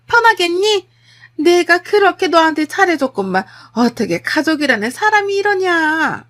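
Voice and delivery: high, loud, wailing reproach; outraged mother-in-law. Acted. Mother-in-law confronting daughter-in-law at home, outraged. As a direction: heap guilt on her at full volume.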